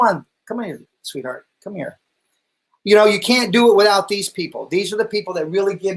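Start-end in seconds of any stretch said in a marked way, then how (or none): 0.5-2.0 soft, much quieter coaxing; tender call. Real, not acted. Husband softly calls his wife onto camera. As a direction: murmur to your wife lovingly.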